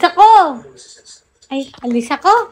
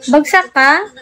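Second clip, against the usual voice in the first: ordinary delivery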